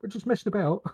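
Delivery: high-pitched imitation of his wife; quoting his wife. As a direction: mimic a wife's excuse, pitched well up. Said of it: "she went".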